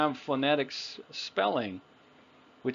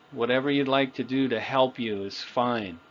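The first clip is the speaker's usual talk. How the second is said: ordinary talk, nothing marked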